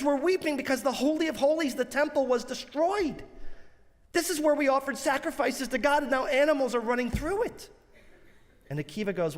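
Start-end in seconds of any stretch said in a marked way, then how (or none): 0.0-7.7 raised, high-pitched lament without sobbing; retold weeping. Rabbis explain their tears to Akiva, mock-tearful lament. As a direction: act out the rabbis' distressed protest. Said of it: "they start crying".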